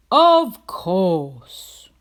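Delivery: loud, friendly builder voice. A narrator's reading. Builder agreeing to sell the pig bricks. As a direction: answer warmly like a sturdy tradesman. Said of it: "replied the builder".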